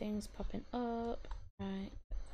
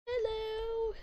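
high cute hello; 12 semitones higher than usual. Pretend. Greeting Sim in cute voice.

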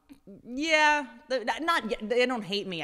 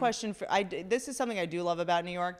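ordinary talk

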